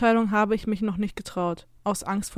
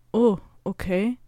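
slow, hesitant acceptance; slower than usual. A narrator's reading. Mother taken aback by the new name, hesitant. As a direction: bring it out haltingly, taken aback. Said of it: "bringt sie zögerlich hervor".

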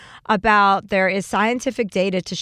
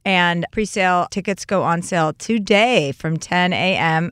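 usual speaking voice

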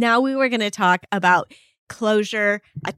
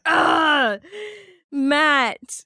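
mock-annoyed ugh at her husband; drawn-out disgusted sigh of his name; wife groaning at husband's joke, mock annoyed; exasperated groan; 5 semitones higher than usual. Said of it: "I'm always like".

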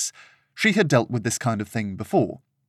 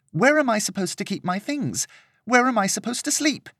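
high, pitched-up outraged socialite; a pampered young lady complaining; spoiled indignant lady; 9 semitones higher than usual. Acted. Serena complaining to Peter about the lodgings, high and indignant.

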